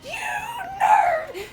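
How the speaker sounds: very high, strained yell; mock chasing shout. Officer to the suspect running away, mock shout. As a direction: screech it slowly after someone, hammed up. Yells after the fleeing suspect.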